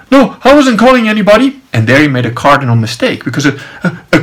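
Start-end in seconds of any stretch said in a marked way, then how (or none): 0.1-1.6 high, loud mock protest; defensive denial. Guard denies calling anyone, defensive. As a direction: imitate someone hotly denying it.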